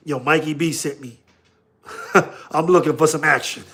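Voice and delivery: lightly acted low-key speakeasy patron. Customer giving the password at a speakeasy door, lightly acted low voice. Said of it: "It's like a speakeasy".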